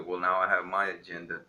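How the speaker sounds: ordinary talk, nothing marked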